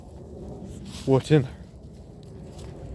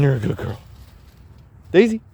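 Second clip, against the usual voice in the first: ordinary talk, nothing marked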